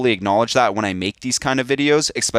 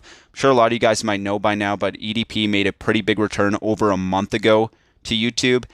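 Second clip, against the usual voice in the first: ordinary talk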